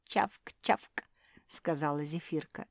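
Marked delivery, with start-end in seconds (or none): 0.1-1.0 spoken chomping noise; munching. A narrator's reading. Zefirka keeps eating as she talks, munching. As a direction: smack out the munching sounds.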